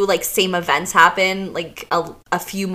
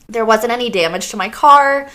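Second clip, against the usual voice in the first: usual speaking voice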